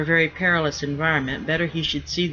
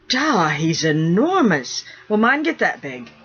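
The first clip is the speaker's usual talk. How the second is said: put-on boy's amazed blurt, higher and louder; 3 semitones higher, 5 dB louder than usual. Young son gaping at the naked rigger, amazed. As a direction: blurt it out wide-eyed. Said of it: "Robert blurted".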